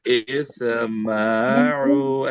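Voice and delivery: "इस्माउ" stretched very slowly; drawn-out call. Shown as a demonstration. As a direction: call "इस्माउ" out long, pointing to the ear for children. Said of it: "कान पे जो है ना इशारा करके बोलना".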